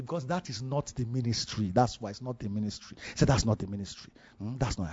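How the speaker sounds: quietly impart it, as a mentor would; hushed retold verdict. Mentor rules on the dream's meaning, low and hushed.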